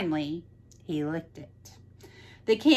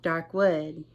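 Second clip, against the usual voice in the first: plain reading voice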